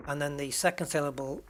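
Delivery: normal talking voice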